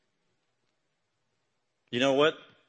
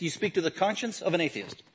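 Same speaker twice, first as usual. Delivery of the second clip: ordinary talk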